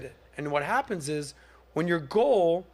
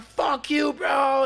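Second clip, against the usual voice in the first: high, loud mocking yell at him; imitating a hater shouting an insult; 9 semitones higher, 4 dB louder, much slower than usual. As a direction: bark it like an online troll.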